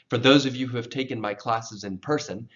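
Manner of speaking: ordinary talk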